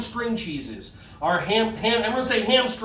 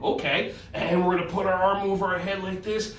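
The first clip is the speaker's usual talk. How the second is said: ordinary delivery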